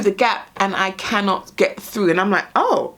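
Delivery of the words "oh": surprised. Retold or imitated. Stuck in the gap.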